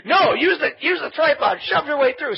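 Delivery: high, loud barked command; mock-frantic yelled instructions; urgent retold order. Retold shouted orders in crowd.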